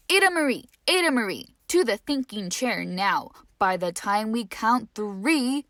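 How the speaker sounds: loud, stern telling-off; scolding parent. Acted. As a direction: send the child to the chair. Parents scolding their daughter.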